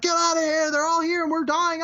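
high frantic yell of a dying soldier; panicked soldier. Retold or imitated. Soldier fleeing into the camera, high panicked yell. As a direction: scream it like he's running from the bugs. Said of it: "he's like".

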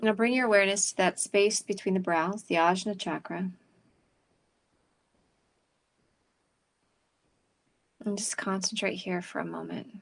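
calm, unhurried breathing guidance; guided meditation. Acted. Leads a meditation.

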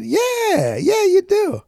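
very high, sing-song mock agreement; sarcastic agreement. Teller humoring the adopted guy, mock agreement. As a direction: play along, obviously not meaning it.

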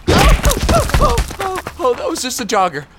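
high pained yelps; terrified horror victim. Acted. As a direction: yelp in pain then relief. Another impact.

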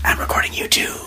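sly whispered tease. Real, not acted. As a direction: whisper it like a secret. Teasing secret to child.

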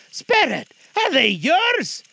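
high, loud, slow shaken plea; Scrooge voice. Acted. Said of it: "Scrooge could say no more".

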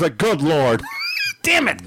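high shocked exclamation. Real, not acted. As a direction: yelp at the total. Shock at the bill.

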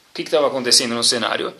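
ordinary talk

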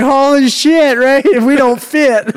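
hammed-up squeal of panic; imitated drunken alarm. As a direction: act out rowdy guys panicking. Drunk guys in crashing truck.

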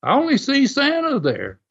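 high-pitched mock innocence; retold calm denial. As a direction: imitate someone playing dumb, unruffled. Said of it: "Les very calmly just says".

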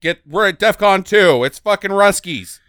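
urgent report, low at first then loud; radar operator in alarm. Retold or imitated. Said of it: "did norad not be like".

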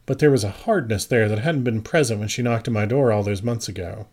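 plain reading voice

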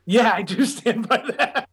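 slow, laughing through the words; laughing admission. Real, not acted. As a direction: own up to it with a laugh breaking in. Laughs while admitting his film's mess.

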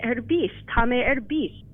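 careful Irish demo, ERbís stressed and falling; Irish pronunciation. Shown as a demonstration. Teacher showing the host how to say it in Irish. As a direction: model tá mé erbís slowly for the host. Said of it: "Oh, you could say".

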